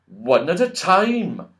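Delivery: slow, high commanding wizard; wizard's command. A narrator's reading. Wizard halting the squabbling friends, slow and commanding. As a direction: speak each word like a wizard giving orders. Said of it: "said the wizard".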